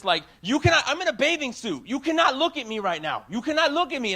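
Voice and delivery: imitating the indignant mother; imitated angry yell. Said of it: "she started yelling".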